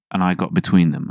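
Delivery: plain reading voice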